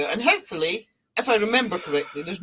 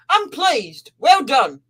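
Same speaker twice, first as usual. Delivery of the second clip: higher, louder hearty praise; pleased praise; 5 semitones higher, 8 dB louder, slower than usual. Acted. Father of all spirits praising the Sun Mother's work, hearty. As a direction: praise her like a boss.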